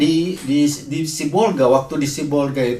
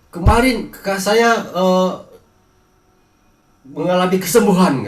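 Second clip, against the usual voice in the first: imitated calling out, higher and somewhat louder; retold shout; 5 semitones higher, 4 dB louder than usual. Friend reports sibling's healing from the street, retold mild shout. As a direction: relay the news in a raised, higher voice. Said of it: "dia itu berteriak dari jalan".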